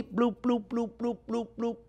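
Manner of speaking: soft, evenly spaced high bloops; slow simmer bubbling. Shown as a demonstration. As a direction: imitate a gentle, slow simmer. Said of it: "let that cook very slowly".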